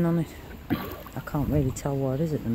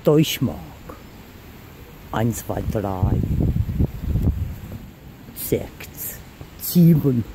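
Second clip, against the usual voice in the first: slow, deliberate mock-German; poor German accent; 3 semitones lower, much slower than usual. Finder playing at German words and numbers, poor German accent. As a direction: count out in a badly done German accent. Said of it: "that's my poor German accent".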